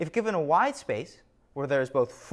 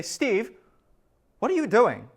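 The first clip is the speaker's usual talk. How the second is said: raised, louder startled question; baffled call; 6 semitones higher, 6 dB louder than usual. Pretend. To Steve dancing at dawn, a startled call.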